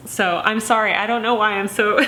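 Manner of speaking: real tears breaking into a laugh; emotional. Real, not acted. Vlogger apologizing to viewers for getting so emotional. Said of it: "emotional about this".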